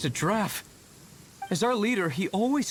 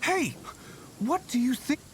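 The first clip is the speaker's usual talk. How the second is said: calling out; 7 semitones higher than usual. Acted. Calling out to stop hunting.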